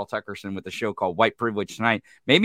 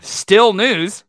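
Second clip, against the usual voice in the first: louder, higher announcer call; mock news jingle; 9 semitones higher, 7 dB louder than usual. Riffing on breaking news with a co-host, announcer call. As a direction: announce it like a headline.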